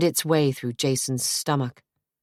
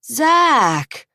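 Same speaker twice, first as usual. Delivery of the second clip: high, drawn reproach; reproachful; 10 semitones higher, 6 dB louder than usual. A narrator's reading. Jason to his brother, reproachful. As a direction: scold him with his name. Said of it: "his voice full of reproach".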